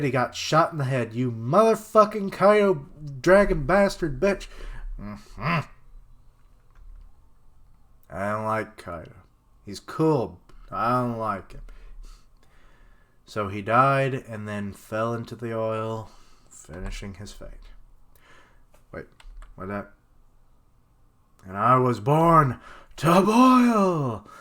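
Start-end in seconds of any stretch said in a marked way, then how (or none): 1.1-4.5 higher, louder angry cursing; furious outburst. Real, not acted. Outraged as hero gets shot. 21.4-24.3 hammed-up heroic declaration. Dramatic recitation of hero's last line.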